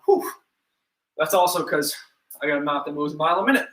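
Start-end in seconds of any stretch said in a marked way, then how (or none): 0.0-0.3 tired whew blowing out air; winded exhale. Real, not acted. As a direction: puff out a breathless whew. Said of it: "I'm even out of breath right now".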